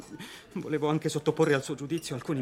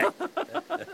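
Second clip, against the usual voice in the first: high giddy laugh. Acted. Journalist laughs in disbelief.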